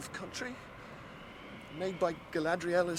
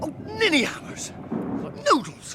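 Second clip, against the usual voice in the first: high, sudden cry of dismay; dismayed outburst; 13 semitones higher, slower than usual. Acted. Sam realises the rope is left behind, high dismayed cry. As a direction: blurt it out in shock.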